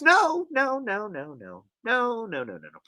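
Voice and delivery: no no no trailing off to nothing; emphatic no that fades away; fading no's. Real, not acted. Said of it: "emphatic denial fading out".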